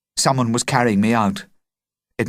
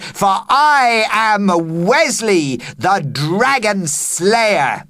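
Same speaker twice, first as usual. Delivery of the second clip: heroic proclamation; 10 semitones higher, 4 dB louder, much slower than usual. Acted. Boy proclaims himself hero.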